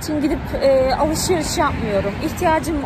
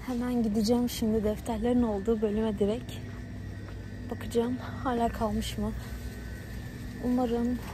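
quiet at night; 9 dB quieter than usual. Real, not acted. Talking softly inside the shop.

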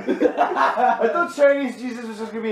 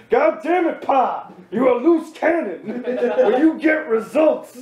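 angry police chief; 6 semitones higher than usual. Pretend. Police chief chewing out his cop, hammed-up shouting. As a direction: blow up at the loose cannon cop. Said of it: "I go from the voiceover to Pi".

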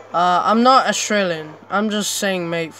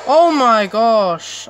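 loud, raised, slow and drawn out; shocked exclamation; 4 semitones higher, 8 dB louder, much slower than usual. Real, not acted. Player did not see that coming, shocked exclamation. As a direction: stretch it out in astonishment.

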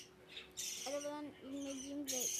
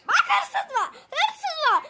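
loud high shout with laughter; laughing shout; 11 semitones higher, 15 dB louder, slower than usual. Real, not acted. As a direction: call out the thief, laughing. Alerting about thief.

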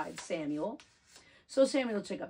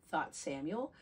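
ordinary delivery

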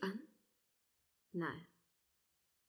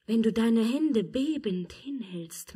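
ordinary talk, nothing marked

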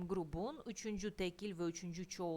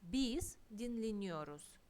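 normal talking voice

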